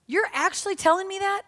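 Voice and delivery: pitched high in disbelief; incredulous. Acted. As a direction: ask it in disbelief, voice shooting up. Incredulous retort to Jesus.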